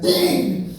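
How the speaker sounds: soft breathy curse; whispered dismay. Retold or imitated. Comic whispering dismay at a hundred questions. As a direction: mutter it to yourself. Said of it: "I'm like".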